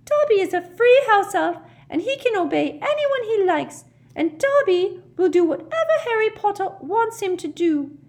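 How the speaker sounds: very high, loud house-elf voice; Dobby. Acted. Tearful elf pledging himself to Harry Potter, fervent squeak. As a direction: declare devotion fervently in a squeaky pitch. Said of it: "tears now streaming down his shriveled little face".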